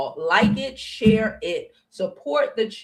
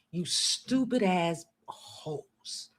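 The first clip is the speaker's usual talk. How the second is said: very slow, soft and scornful; quietly scornful jab; slow contempt; 2 semitones lower, 7 dB quieter, much slower than usual. Real, not acted. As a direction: drag out the insult. Slow insult.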